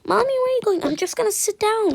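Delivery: put-on high child voice asking for mommy; little kid voice. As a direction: play a small child.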